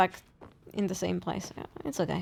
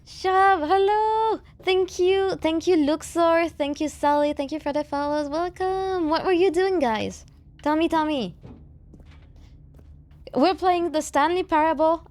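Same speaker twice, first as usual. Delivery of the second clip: high chirpy thank-you voice; sweet bubbly welcome to chat; cute anime streamer; 6 semitones higher than usual. Real, not acted. Greeting raiders on stream.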